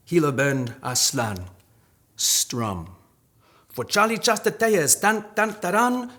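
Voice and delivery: high, loud spoken-word delivery; poetry performance. Acted. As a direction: perform it like a corrido ballad. Performs poem at reading.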